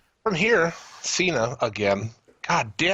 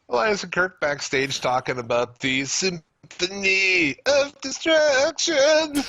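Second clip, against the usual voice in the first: announcer voice; 8 semitones higher, slower than usual. Acted. Dramatic drawn-out match name.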